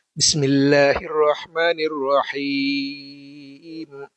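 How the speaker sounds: slow, drawn-out Quran recitation. Shown as a demonstration. Teacher demonstrating correct recitation for students, slow chant. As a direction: chant each syllable long and even. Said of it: "Baik, perhatikan".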